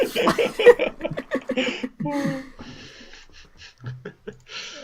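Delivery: very high, breathless burst of laughing; high helpless laughter. Real, not acted. Host cracking up at his own missed award, laughing hard. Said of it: "Fair enough".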